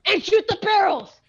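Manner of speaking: high, loud shout to act now; urgent shout. Acted. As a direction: yell the order at the stalling player. Urging teammate in battle.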